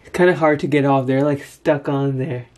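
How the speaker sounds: fingers in mouth peeling; talking while removing strips; whitening strips on teeth. Real, not acted. Seen 2: Fingers at teeth pulling off clear strips during final words.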